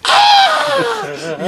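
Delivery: high, laughing cartoon cry of pain; high laughing yelp. Acting out a card hitting a hand.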